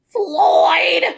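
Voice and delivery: high, loud calling out; lady across the street shouting at Floyd; shouted name. Acted. Neighbor lady yells at Floyd.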